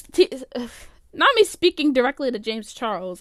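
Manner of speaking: usual speaking voice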